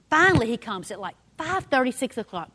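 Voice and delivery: normal talking voice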